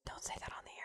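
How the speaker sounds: urgent hushed whisper. Real, not acted. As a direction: hiss it so the mic misses it. Hushing co-host on live radio.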